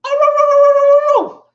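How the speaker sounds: very high, loud yapping barks; yappy dog barks. Retold or imitated. Hyper young dog yaps while running around the yard. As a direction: yap like a spastic little pooch. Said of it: "chases them into one corner".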